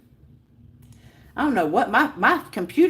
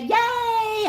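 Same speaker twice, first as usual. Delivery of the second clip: high, delighted British-free yay; happy cheer; 12 semitones higher than usual. Real, not acted. Streamer cheering viewers' birthday wishes, happy high cheer. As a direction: cheer in a much higher pitch. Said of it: "I'm so happy".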